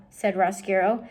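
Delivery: ordinary delivery, nothing marked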